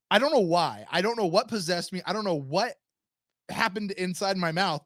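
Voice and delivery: ordinary talk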